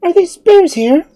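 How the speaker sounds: put-on very high, loud girlish question; alarmed. A narrator's reading. Girl learning bears are near, alarmed. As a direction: ask it in startled alarm.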